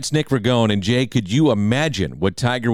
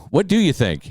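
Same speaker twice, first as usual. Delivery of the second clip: ordinary talk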